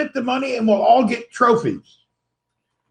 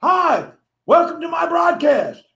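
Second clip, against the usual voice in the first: higher, louder hammed-up host shout; mock broadcast welcome; 7 semitones higher, 3 dB louder than usual. Copying the stiff-armed host's greeting for viewers, a higher mock shout. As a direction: belt it out like a cheesy live-stream host. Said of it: "I think I'm gonna start doing it".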